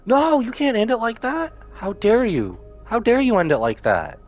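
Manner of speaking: ordinary delivery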